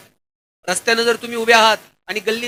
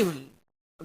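usual speaking voice

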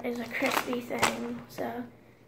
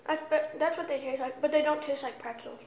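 talking between bites; chewing while talking; 6 dB quieter than usual. Real, not acted. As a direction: mouth full of chocolate. Seen 1: Puts chocolate piece into mouth, hand at lips, chewing while talking.

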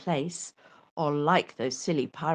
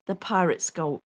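ordinary delivery

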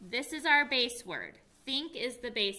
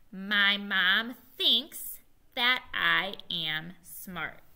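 slow choral reading; 6 dB louder, much slower than usual. Shown as a demonstration. Teacher leading the class in a slow choral read. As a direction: read slowly word by word. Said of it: "Can you say it with me".

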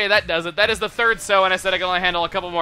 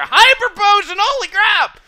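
high, loud delighted yell; excited shout; 14 semitones higher, 9 dB louder, slower than usual. Real, not acted. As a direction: shriek with joy at the find. Finding a rare item.

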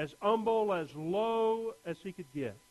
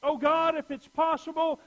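higher, louder pleading cry to God; desperate prayer; 8 semitones higher, 8 dB louder than usual. Pretend. Pastor voicing the believer's plea, desperate cry. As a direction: beg God to spare you.

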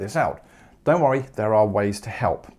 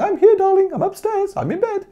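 put-on very high, sweet old lady voice; granny's voice; 5 dB louder than usual. Grandmother calling down to the girl, very high and sweet. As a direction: coo it like a doting granny. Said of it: "Granny's not saying".

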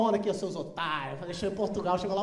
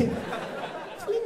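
normal talking voice

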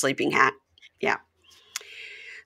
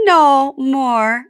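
mock teasing; 10 semitones higher, 7 dB louder than usual. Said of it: "And she goes".

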